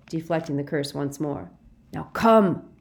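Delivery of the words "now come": loud, raised command; shouted order. A narrator's reading. Snape shouting to the Death Eater, commanding. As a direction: call him away sharply. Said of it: "he shouted at the huge Death Eater".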